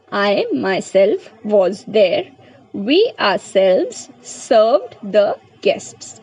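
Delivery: reads each English example word by word, very slowly; slow dictation. Shown as a demonstration. Teacher reading examples aloud to students, very slow dictation. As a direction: spell the sentence out slowly for the class.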